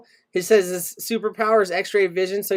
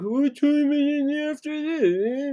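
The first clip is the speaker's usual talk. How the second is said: imitating Snorkel; 8 semitones higher, slower than usual. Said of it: "Snorkel's like".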